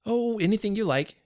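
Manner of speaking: a put-upon prisoner, exasperated; tried patience. Acted. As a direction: toss it off, a good deal tried. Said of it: "as if he were being a good deal tried".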